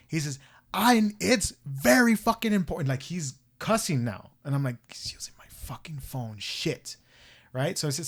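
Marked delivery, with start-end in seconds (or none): 0.7-2.9 imitated angry caller, higher and louder; irate outburst. Retelling old man cussing on borrowed phone, irate. 4.9-7.0 hushed, whispered swearing to oneself; whispered dismay. Retold or imitated. Woman realizing stranger is misusing her phone, whispered dismay. Teller's alarmed thought to herself.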